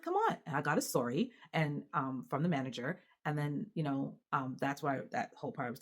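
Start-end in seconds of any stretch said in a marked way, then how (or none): 0.0-0.4 exasperated. Retold or imitated. Exasperated reply to swim school.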